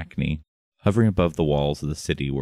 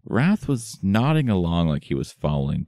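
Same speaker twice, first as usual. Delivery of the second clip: ordinary delivery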